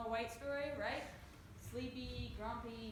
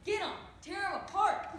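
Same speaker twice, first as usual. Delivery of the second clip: loud, higher mock attack order; fierce command; 6 semitones higher, 7 dB louder than usual. English teacher ordering her dogs, mock loud command. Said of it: "Missus Lewis is like".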